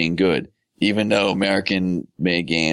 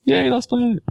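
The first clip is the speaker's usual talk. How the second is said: very high-pitched fake little "yay"; sarcastic cheer; 14 semitones higher than usual. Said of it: "Sorry, that was sarcasm".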